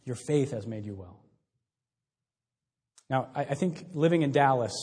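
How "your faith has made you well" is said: softer, lower echo of the blessing; soft repeat. Real, not acted. Preacher repeating the line to the congregation, softly and lower. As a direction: let it sink in, drop the voice.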